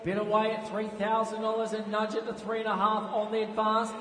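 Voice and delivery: rapid rhythmic auction patter; auctioneer calling. Acted. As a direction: call the bids like an auctioneer.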